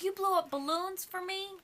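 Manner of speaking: high, astonished question; touched surprise. Retold or imitated. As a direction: gasp it out like a delighted kid. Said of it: "She goes".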